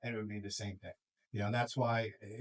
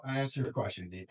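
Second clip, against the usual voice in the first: ordinary talk, nothing marked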